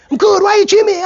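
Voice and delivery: high, loud pushy question; gym guy asking. Retold or imitated. Gym regular asking why he gyms, high and loud. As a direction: demand it like an eager gym regular.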